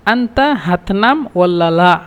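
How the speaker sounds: slow, careful teaching repeat; drawn-out model question; slow demo. Shown as a demonstration. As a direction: say the question slowly so students catch it.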